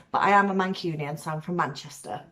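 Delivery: ordinary talk, nothing marked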